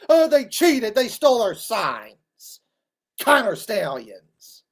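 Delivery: sarcastic crybaby imitation; mock whiny fan. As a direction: whine like a sore loser. Mocking fans again.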